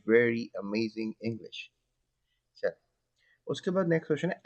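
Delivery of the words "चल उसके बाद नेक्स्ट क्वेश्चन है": usual speaking voice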